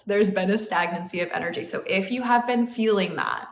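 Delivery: ordinary delivery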